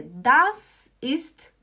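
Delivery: very slow, each word stretched out; patient teacher drawing out the German; slow model answer. Shown as a demonstration. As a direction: model it slowly for beginners. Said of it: "Aí a resposta é".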